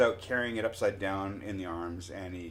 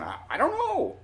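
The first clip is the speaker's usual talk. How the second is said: very high, louder strained helpless cry; exaggerated mouthing; 6 dB louder than usual. Telling brother and buddy across the lot, exaggerated high helpless mouthing. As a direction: act out a helpless message pitched way up. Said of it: "I'm mouthing".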